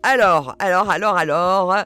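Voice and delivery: buy time with a loud, playful alors; playful stalling. Real, not acted. Answering a fan's question, she stalls loudly and playfully.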